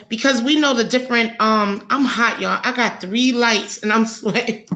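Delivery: usual speaking voice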